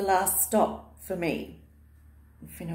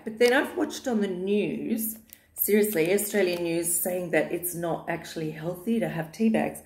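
ordinary delivery

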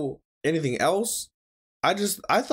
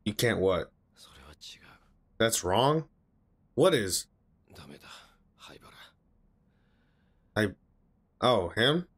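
low, subdued reading voice; subtitle read-aloud; 10 semitones lower, 6 dB quieter than usual. A narrator's reading. Viewer reading anime dialogue to himself, subdued read-aloud.

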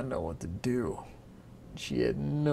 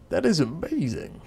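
loud, higher delighted king; excited king; 4 semitones higher, 7 dB louder than usual. Acted. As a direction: burst out with glee.

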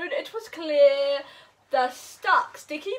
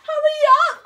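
higher, louder urgent call; cried urgently; 8 semitones higher, 8 dB louder, much faster than usual. Acted. Hippo urging the animals, urgent cry. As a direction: hurry them along. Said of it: "cried Hippo".

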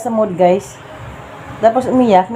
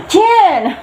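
surprised gasp; 10 semitones higher than usual. Real, not acted. Mother playing face game.

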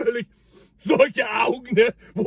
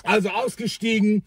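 ordinary delivery

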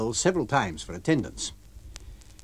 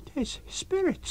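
high, slow frightened landlady; Mrs Hall, frightened landlady; 4 dB quieter, slower than usual. Acted. Scared landlady telling her husband. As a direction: shaken innkeeper's wife crying spirits.

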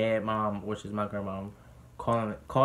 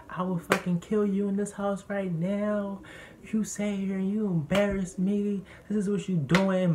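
high, raised mock threat; imitated threatening dad; 9 semitones higher than usual. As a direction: play back a furious father's threat, pitched up. Retold dad threatening his son.

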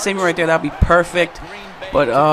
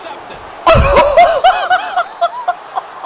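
long, high-pitched laugh; drawn-out high laughing fit; helpless laughter. Real, not acted. Reacts to interception.

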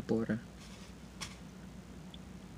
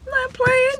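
high insistent. Real, not acted. Emphatic about the cold.

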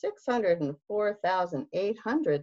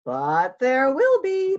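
higher, louder bear voice; Bear character voice; 7 semitones higher, 7 dB louder than usual. Acted. Bear insisting to hare, raised voice.

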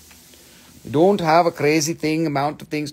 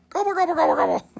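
turkey gobble; 13 semitones higher, much faster than usual. Pretend. Imitating a turkey.

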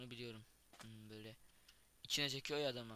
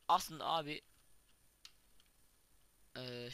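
normal talking voice

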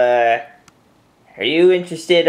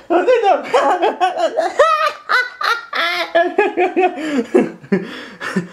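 hearty laugh. Real, not acted. After the earwig prank.

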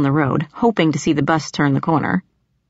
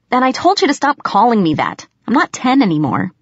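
annoyed; 6 semitones higher than usual. A narrator's reading. Annoyed at nickname.

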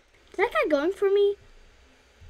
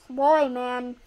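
normal talking voice

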